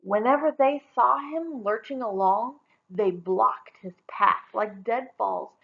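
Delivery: plain reading voice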